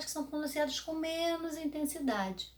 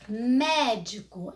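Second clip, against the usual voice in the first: médico loud, with a long drawn-out "mé"; calling the word; 6 dB louder, much slower than usual. Shown as a demonstration. Teacher calling the word for pupils, long loud mé. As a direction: stretch and hit the mé of médico. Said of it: "Basta você chamar a palavrinha".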